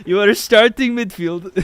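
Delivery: put-on high, loud German coach accent; hammed-up German accent. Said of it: "I can't do a good German accent right now".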